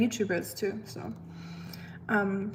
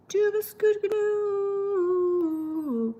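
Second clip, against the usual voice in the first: much higher, slow German sweetness; sing-song German; 10 semitones higher, much slower than usual. Pretend. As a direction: croon it high and slow.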